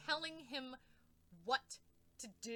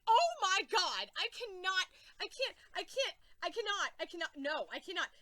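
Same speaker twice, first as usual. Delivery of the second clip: overwhelmed; 6 semitones higher, 8 dB louder than usual. Real, not acted. Can't handle the scene.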